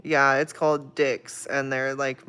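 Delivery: ordinary talk, nothing marked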